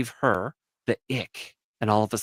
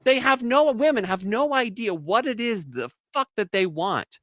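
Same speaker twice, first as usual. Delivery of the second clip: exasperated rant; 11 semitones higher, 5 dB louder than usual. Real, not acted. Ranting about women, exasperated.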